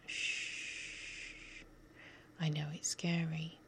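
ordinary talk, nothing marked

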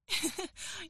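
light teasing laugh; high amused giggle. Acted. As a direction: giggle at how cute he is. Laughs at listener's excitement.

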